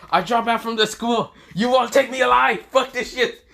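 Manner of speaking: high, loud frantic whine; panicked escape. Acted. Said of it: "this is where he says".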